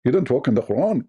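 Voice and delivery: usual speaking voice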